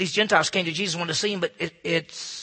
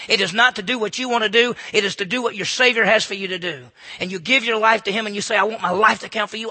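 louder preaching, driving the challenge home; preaching at full force; 4 semitones higher, 9 dB louder than usual. Acted. Preacher challenging the young generation, loud preaching. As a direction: push the challenge at the young crowd.

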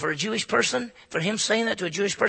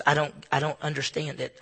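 ordinary delivery

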